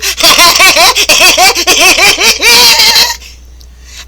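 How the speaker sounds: high loud mocking cackle; evil cackle. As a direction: cackle like a gloating villain. Mock villain laugh.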